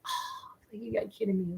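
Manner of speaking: airy gasp into a soft, high murmur; hushed disbelief. Retold or imitated. Moviegoer recalls reacting to deaths, in hushed disbelief. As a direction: act out being stunned, barely any voice.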